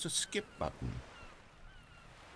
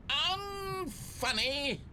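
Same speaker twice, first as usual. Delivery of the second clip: slow, high, offended echo of the word; indignant disbelief; 14 semitones higher, much slower than usual. Acted. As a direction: drag it out, wounded and incredulous. Narrator stung by a review.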